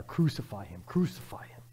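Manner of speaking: normal talking voice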